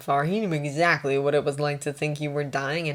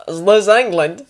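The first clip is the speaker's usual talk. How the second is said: loud Australian patient asking; disoriented Australian, voice raised; Australian accent; 4 semitones higher, 9 dB louder than usual. Acted. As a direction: ask it loudly in an Australian accent. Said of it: "Australian, that was what the accent".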